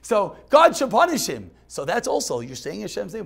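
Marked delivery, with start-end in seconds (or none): 0.0-1.5 emphatic repeat. Shown as a demonstration. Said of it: "repeating translation".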